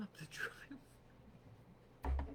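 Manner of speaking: fast whisper with a laugh. Real, not acted. About the movers, fast giggly whisper.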